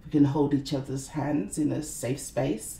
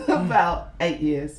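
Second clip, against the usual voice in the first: answers through a real laugh; 3 semitones higher, 6 dB louder, slower than usual. Real, not acted. Guest laughing as she admits eight years. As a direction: laugh the words out.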